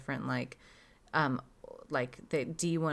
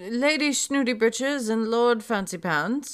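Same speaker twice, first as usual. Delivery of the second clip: higher, louder mock-aristocratic announcing voice; snooty party guest; 6 semitones higher, 5 dB louder than usual. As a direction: introduce pompous nobles with hammed-up snootiness.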